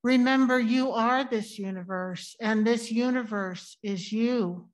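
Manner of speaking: ordinary talk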